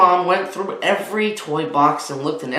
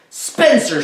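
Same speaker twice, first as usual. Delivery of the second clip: higher, louder mother calling out; screamed name; 8 semitones higher, 5 dB louder than usual. Acted. Mother sees the toy pile and yells for Spencer. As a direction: call him like you just saw the mess. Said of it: "she screamed".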